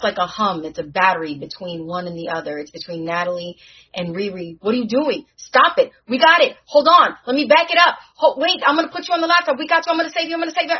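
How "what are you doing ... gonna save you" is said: loud, high, frantic pleading; desperate shouting to save a dying friend; panicked. Acted. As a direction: scramble to save her before she is gone. Panicked as soul disappears.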